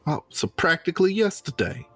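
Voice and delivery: usual speaking voice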